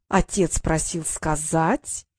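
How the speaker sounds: slow, drawn-out start; slowly. Acted. Brother slowly starts a fake message, drawn-out. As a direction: draw it out to build suspense. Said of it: "медленно начал Норт".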